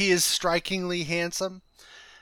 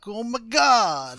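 1970s heartthrob voice; 5 semitones higher, 5 dB louder, slower than usual. Acted. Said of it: "He's like".

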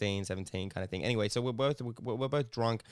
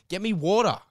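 higher, louder barked order; rude demand; 9 semitones higher, 8 dB louder than usual. Retold or imitated. The sick girl ordering him to fetch water, louder and higher. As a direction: snap it like a rude drunk girl. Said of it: "She was like".

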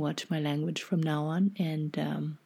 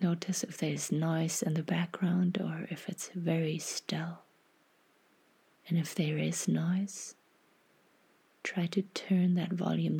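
half-whispered, unhurried guidance; guided meditation. Acted.

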